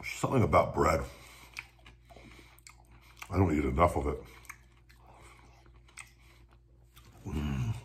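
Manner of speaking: talking between sandwich bites; chewing while talking. Real, not acted. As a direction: mouth partly full. Seen 0: looks down chewing, sandwich in hands, bites again at 7.0.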